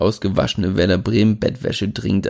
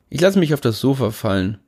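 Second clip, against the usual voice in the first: ordinary delivery, nothing marked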